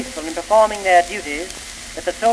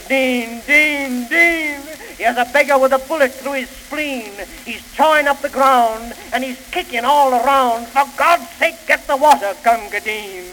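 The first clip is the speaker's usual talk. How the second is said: urgent pleading; 6 semitones higher than usual. Acted. Wounded soldier begging for water.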